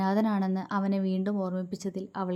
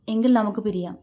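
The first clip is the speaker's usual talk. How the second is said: ordinary delivery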